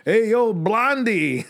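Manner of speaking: slow, loud, high grandpa holler; hollering like an old man at a diner; old grandfather calling a waitress. Retold or imitated. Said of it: "I turned into my fucking grandfather".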